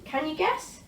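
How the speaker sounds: ordinary delivery, nothing marked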